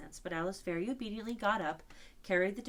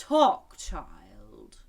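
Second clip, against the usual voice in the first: put-on a creature ordering the girl to speak; unicorn's command; 6 semitones lower, 13 dB louder than usual. A narrator's reading. Unicorn bidding Alice to talk, commanding.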